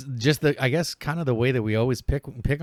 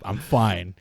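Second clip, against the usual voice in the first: normal talking voice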